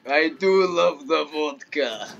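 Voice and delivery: mock Russian accent. Player posing as a Russian to his friend, mock Russian accent. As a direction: play a cartoon Russian who loves vodka. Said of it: "What the fuck accent was that?".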